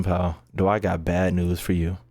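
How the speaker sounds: normal talking voice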